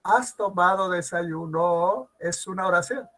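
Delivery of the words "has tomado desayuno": drawn-out question with "desayuno" sweeping upward; rising question intonation. Shown as a demonstration. Teacher repeats the interrogative example, ending "desayuno" on a rise. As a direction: ask slowly, pitch climbing through the end of "desayuno". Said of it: "tiene entonación propia ascendente".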